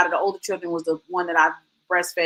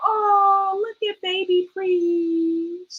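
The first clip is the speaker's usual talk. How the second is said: high, very slow, doting coo; doting, drawn out and high; tender awe; 9 semitones higher, much slower than usual. Pretend. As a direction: coo it slowly like a proud mom. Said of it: "when I go".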